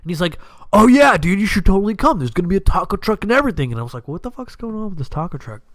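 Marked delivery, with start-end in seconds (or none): 0.7-3.7 higher, fast bro voice gushing an invite; eager dude voice. Retold or imitated. 4.1-5.6 quieter, faster puzzled aside; baffled aside. Retold or imitated.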